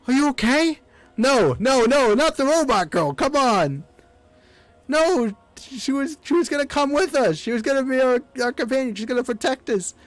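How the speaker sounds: put-on high, pleading, panicked young voice; distressed kid voice. Young hero pleading with the falling robot girl, distressed. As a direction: beg her not to go.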